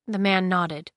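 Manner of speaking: plain reading voice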